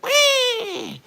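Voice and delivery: high mock meow; cat meow. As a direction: imitate the cat's cry. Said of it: "she was like".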